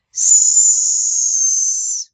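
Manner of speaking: a long loud hiss on "S", no voice behind it; hissed S sound. Shown as a demonstration. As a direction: hiss a strong S to show there is no vibration. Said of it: "make the S sound".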